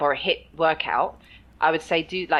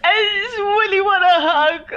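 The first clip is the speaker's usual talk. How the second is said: high, slow, pleading little voice; a mock-needy high plea, louder; needy whimper; 12 semitones higher, 6 dB louder, slower than usual. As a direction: whine for a hug like a sulky child.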